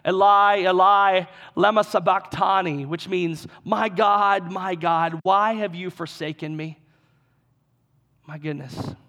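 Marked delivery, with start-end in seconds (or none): 0.1-6.8 loud anguished cry of Eli, Eli. Retold or imitated. Preacher voicing Jesus on the cross, loud anguished cry. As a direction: cry it out loud like a man in agony. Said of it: "Jesus cried out in a loud voice".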